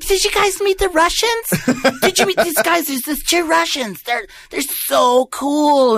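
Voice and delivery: very high falsetto, drawn out; mock excited girl. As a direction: gush like a gullible party girl. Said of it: "this girl's like".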